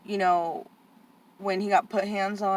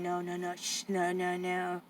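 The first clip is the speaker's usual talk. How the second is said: much softer, hushing no no no; shushing; 11 dB quieter, slower than usual. Retold or imitated. Staff woman shushing the nephew in background, soft. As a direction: hush him gently. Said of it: "telling him".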